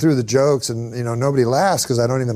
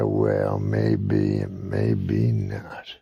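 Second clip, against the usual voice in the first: low, slow rasping whisper; menacing whisper; 5 dB quieter, much slower than usual. Retold or imitated. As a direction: drag it out in a creepy hush.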